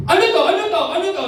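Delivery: loud, high angry bark of a question; angry father shouting. Retold or imitated. Father confronting his kids at the table, angry shout. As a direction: demand it like a furious dad. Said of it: "yung tipong galit na galit niya".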